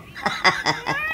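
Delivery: high giggle. Acted. Laughing at joke.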